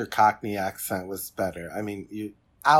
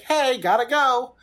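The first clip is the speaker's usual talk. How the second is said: breezy exit; 12 semitones higher, 7 dB louder than usual. Retold or imitated. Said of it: "it's like".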